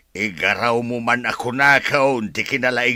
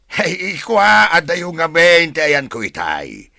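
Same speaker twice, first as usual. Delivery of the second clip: much louder, higher, slow call; loud protest; 6 semitones higher, 7 dB louder, slower than usual. Acted. Woman loudly protesting a relative's prying. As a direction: push back hard, almost shouting.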